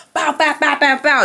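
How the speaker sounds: mouth-made gunshot noises; rapid mock gunfire from the mouth; imitated shots, bow bow; gunshot sounds.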